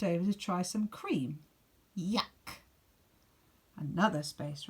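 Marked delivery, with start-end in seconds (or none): 1.9-2.6 rising disgusted yuck; a rabbit's mild yuck. Acted. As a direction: spit it out in distaste. Rabbit tastes the cream.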